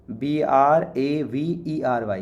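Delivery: slow, letter-by-letter spelling. Shown as a demonstration. Teacher spelling bravery out slowly for students. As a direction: spell it out one letter at a time. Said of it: "spelling out a word letter by letter".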